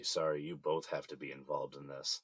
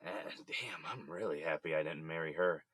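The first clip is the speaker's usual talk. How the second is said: ordinary talk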